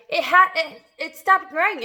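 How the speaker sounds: high, loud excited cry. A narrator's reading. Aunt cries out that it stopped, high and loud. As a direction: blurt it out. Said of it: "Aunt Spiker cried".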